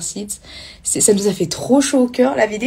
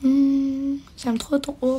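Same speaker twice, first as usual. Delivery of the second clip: normal talking voice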